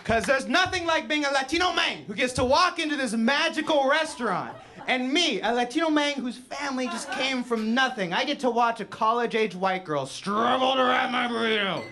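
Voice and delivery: high-pitched, shouted mock-heroic speech; hammed-up fervor at full voice; impassioned proud rant. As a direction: build to an overblown cry of triumph.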